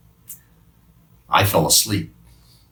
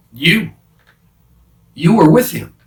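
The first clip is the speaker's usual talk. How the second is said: put-on accusing girl; 9 semitones higher, 7 dB louder than usual. Girl accuses Peter at door.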